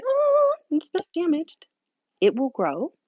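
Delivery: high bright reassuring "Oh"; reassuring stylist. Retold or imitated. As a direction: gently correct the client with encouragement.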